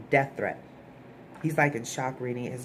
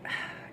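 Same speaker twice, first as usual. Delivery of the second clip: swooning sigh; 10 dB quieter than usual. Real, not acted. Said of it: "I'm like".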